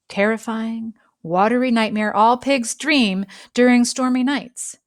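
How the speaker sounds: ordinary delivery, nothing marked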